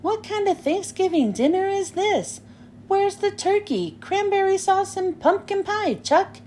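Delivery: put-on a bossy kid grilling Chuck about the missing turkey; indignant Peppermint Patty. A narrator's reading. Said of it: "Peppermint Patty frowns".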